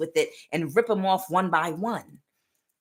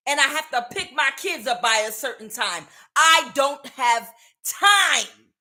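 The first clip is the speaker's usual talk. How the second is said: exasperated mother; 5 semitones higher than usual. Retold or imitated. Repeating the mother's protest, heated.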